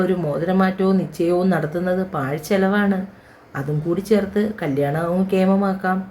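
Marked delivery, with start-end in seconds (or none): none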